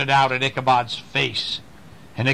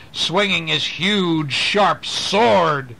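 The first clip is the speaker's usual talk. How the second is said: plain reading voice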